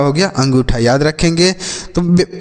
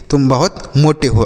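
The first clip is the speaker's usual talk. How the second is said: normal talking voice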